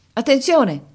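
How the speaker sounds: sharp high yell of alarm; loud urgent shout; shouted warning. A narrator's reading. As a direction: cry out over the roar of the surf. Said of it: "gridò Carjan".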